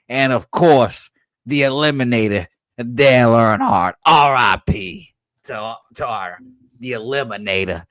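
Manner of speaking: a hint of an old Southern racing fan; lightly hammed Southern accent. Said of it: "accent again".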